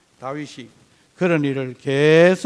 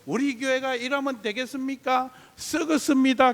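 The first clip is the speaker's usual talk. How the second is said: higher, lamenting prayer voice, lightly imitated; mock complaining prayer; 6 semitones higher than usual. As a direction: play a member griping about the church in prayer. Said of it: "목사를 비판하고 교회를 비판하는 기도를". (교회를 비판하는 기도를 가볍게 흉내 내는 높은 목소리)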